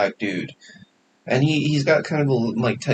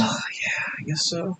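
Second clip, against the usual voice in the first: unvoiced reluctant aside; whispered admission; 6 semitones higher, 9 dB quieter, slower than usual. Real, not acted. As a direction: breathe it, half admitting.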